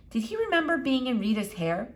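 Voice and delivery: ordinary delivery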